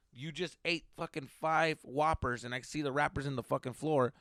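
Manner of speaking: ordinary delivery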